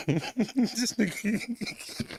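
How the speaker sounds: ordinary talk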